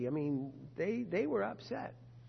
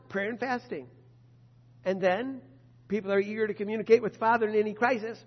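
ordinary delivery

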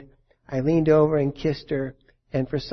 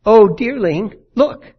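high and louder, lifting sharply on look; clear call; 6 semitones higher, 8 dB louder, slower than usual. Retold or imitated. Dying wife calling to her husband, high and loud. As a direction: call out to him, as if seeing something. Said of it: "in a clear, weak voice".

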